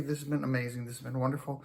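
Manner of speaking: normal talking voice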